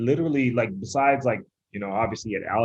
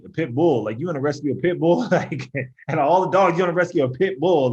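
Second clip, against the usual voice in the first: mock disbelief, rising higher and louder; incredulous; 4 semitones higher, 3 dB louder than usual. Husband balking at his wife's dog suggestion, incredulous. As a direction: balk at the idea, building as it goes.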